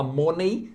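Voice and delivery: "money" with a wrong round o and "ay" ending; mispronounced money. Retold or imitated. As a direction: imitate learners saying "money" as "MOH-nay". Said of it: "tem gente que fala money". ("money" imitando o erro comum "mônei")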